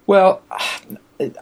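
ordinary talk

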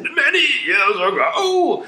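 high, slow, laughing put-on boy voice; crazy boy voice. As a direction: stretch it out like a manic lad. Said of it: "this is my crazy boy voice".

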